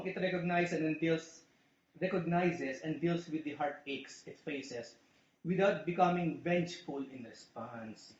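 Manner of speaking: usual speaking voice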